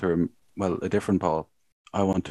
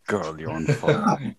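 slow, higher mock flirt; mock flirty comment; 6 semitones higher, 4 dB louder, slower than usual. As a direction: read it like a creepy compliment. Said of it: "I have brought the receipts".

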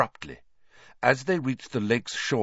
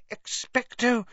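high, thin, faint attempt; strained whisper; 13 semitones higher than usual. A narrator's reading. Hermione attempting the spell against Dementors, whispered. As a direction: try the spell in a high, weak voice. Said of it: "Hermione whispered".

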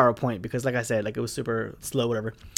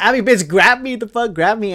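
higher, urgent begging to be grabbed; mock panicked plea; 6 semitones higher, 3 dB louder than usual. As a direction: beg your friend to pull you out. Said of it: "I was like".

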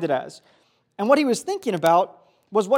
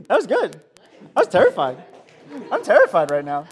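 laughing through words; 5 dB louder than usual. Real, not acted. Preacher laughs, praising the volunteer.

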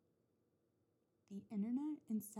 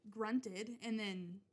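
normal talking voice

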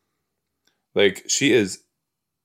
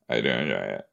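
much lower, softer voice; put-on low voice; 10 semitones lower, 6 dB quieter, faster than usual. Telling viewers she enjoys the accent, put-on low voice. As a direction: drop it way down low and quiet. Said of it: "Do you hear how I'm talking right now?".

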